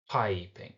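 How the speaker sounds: PIPING as PI-ping, weight on PI; "piping" falling from a stressed first syllable. Shown as a demonstration. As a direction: lean on the PI of "piping", let "ping" drop away. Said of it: "first syllable is a stressed syllable".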